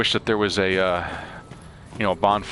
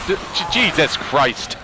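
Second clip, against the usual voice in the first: shocked cry at a jump scare; startled yelp; 9 semitones higher, 4 dB louder than usual. Real, not acted. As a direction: jolt and blurt it out in fright.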